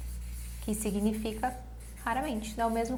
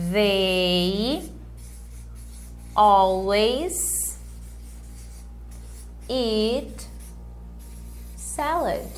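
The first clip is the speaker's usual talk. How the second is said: "They always eat salad" very slow, word by word; slow model sentence; 4 dB quieter, much slower than usual. Shown as a demonstration. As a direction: spell it out slowly for learners. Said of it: "Como é que eu falo sempre". (frase modelo lenta, palavra por palavra)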